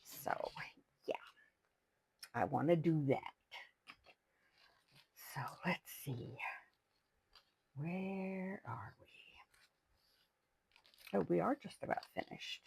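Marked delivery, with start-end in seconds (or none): 5.3-9.1 whisper. Real, not acted. Searching pages, whispering to herself.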